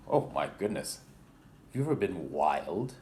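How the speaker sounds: plain reading voice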